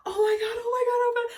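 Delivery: re-enacted freak-out in a high voice. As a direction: gasp and panic with joy. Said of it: "I'm freaking out at this point".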